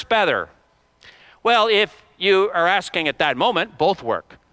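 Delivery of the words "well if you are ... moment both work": usual speaking voice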